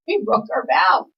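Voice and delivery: high, slow, hammed-up lament; mock upset wail. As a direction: stretch it out like a guilty kid pleading. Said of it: "And I was all upset".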